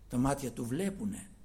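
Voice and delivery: ordinary talk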